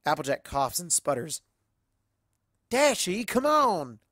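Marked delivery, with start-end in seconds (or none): 2.7-4.0 high, loud flustered protest; embarrassed protest. Acted. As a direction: call her out, mortified. Applejack protests to Rainbow Dash.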